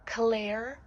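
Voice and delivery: "Claire" loud with a rising end; "Claire" drawn out as kuh-LAIR, questioningly; wrong name pronunciation. Shown as a demonstration. Vlogger voicing how people misread her name, mispronounced "Claire". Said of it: "my name is not pronounced".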